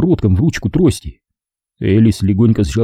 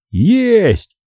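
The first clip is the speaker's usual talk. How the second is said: high, triumphant exclamation; exclaiming; 11 semitones higher than usual. A narrator's reading. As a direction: cry it out in triumph. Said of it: "воскликнул Эверетт".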